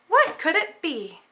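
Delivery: plain reading voice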